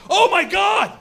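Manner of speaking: high, loud shriek of horror; hammed-up hysterical yelling; panicked shout. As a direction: scream it in sheer panic. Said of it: "I'm starting to panic".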